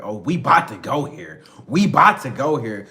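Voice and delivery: loud, insistent acted retort; confrontational. Girlfriend refusing to drop it, loud and confrontational.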